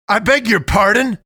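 high, loud, indignant outburst; scandalised yell, pitched right up; affronted shout. A narrator's reading. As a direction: shout back in offended disbelief. Affronted man answers her remark.

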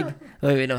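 usual speaking voice

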